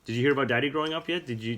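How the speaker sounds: ordinary delivery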